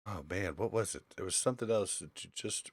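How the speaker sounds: ordinary talk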